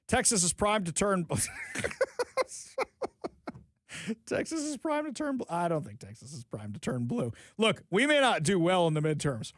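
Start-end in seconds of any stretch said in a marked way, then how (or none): 1.3-3.6 big incredulous burst of laughter; helpless scoffing laugh. Real, not acted. Laughs at the quote. 4.3-5.4 higher, mocking repeat still half laughing; incredulous mock echo of the quote; mocking disbelief. Real, not acted.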